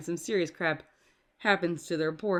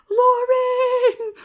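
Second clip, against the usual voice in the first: high, loud, drawn-out shout; full-volume mock calling; imitated mom's yell; 10 dB louder than usual. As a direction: holler the name like a mom calling her kid. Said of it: "And would yell for me".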